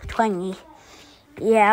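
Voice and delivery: ordinary talk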